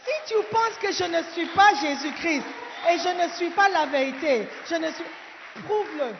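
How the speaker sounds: shouted challenge. Acted. Preacher daring doubters to prove it, shouting. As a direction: hurl the challenge at the crowd.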